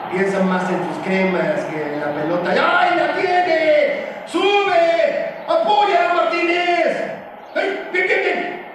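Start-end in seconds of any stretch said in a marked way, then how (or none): 2.5-7.1 high, stretched-out sideline yells; urgent calling out. Acted. Coach urging players up the pitch, urgent shouting. 7.5-8.7 high-pitched burst of encouragement; excited cheering. Acted. Coach praising players during the attack, excited cheering.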